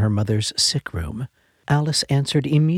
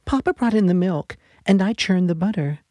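put-on eager little girl; 8 semitones higher, faster than usual. A narrator's reading. Little girl jumping on her sick mother's bed, high eager voice. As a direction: bounce in like an excited young daughter. Child reports chores.

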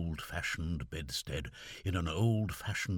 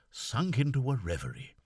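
plain reading voice

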